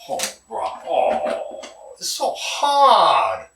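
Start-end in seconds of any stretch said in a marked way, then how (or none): 2.0-3.5 exasperated. Real, not acted. Struggling to speak formal English.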